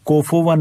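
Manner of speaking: कुफुवन as "kofowan"; faulty "ko-fo" in कुफुवन; O vowels, wrongly. Shown as a demonstration. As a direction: loose O in place of U in कुफुवन. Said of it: "नहीं पढ़ना है".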